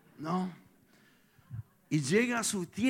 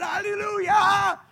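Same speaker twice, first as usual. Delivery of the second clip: long, drawn-out, high shout; shouted hallelujah; 10 dB louder, much slower than usual. Acted. Pastor acting out Israel's victory cry, drawn-out shout. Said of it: "Y después gritan".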